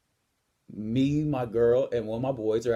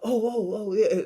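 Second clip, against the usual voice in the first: higher-pitched mock startled backpedal; backing-down bully; 8 semitones higher than usual. Gym bully answering the man who confronted him, mock startled. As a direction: imitate him suddenly caught off guard.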